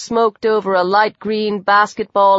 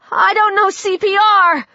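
panicked; 11 semitones higher than usual. A narrator's reading. Panicked cry during a collapse.

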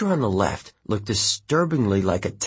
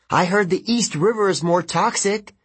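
taunting; 10 semitones higher than usual. Acted. Taunting the river gods.

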